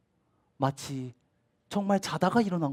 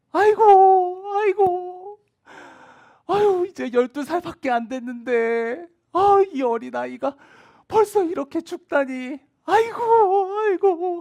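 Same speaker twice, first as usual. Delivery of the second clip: loud, high keening wail with crying; wailing mourners; 12 dB louder, slower than usual. Acted. Mourners wailing over the dead twelve-year-old girl, high loud keening. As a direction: wail aigo at the top of your voice, weeping.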